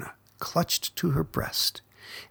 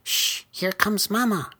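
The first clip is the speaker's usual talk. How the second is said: put-on higher baby bird voice, urgent hush; higher chick voice, hurried warning; hissing; 8 semitones higher than usual. A narrator's reading. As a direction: hush your siblings as mama arrives. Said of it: "hissed Pip".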